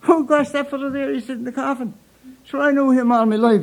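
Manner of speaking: a hint of a chatty old man at a wake; old man's voice. Old man at funeral, quoted.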